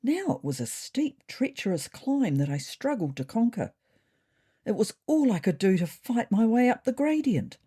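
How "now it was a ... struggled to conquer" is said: usual speaking voice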